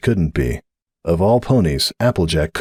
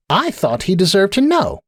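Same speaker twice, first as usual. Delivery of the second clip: high, indignant justification; 12 semitones higher than usual. A narrator's reading. Sunburst finishing his defence, high and indignant. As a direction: stand your ground, pitched up. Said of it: "in an indignant frown".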